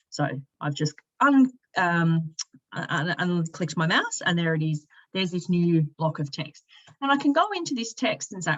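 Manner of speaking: ordinary talk, nothing marked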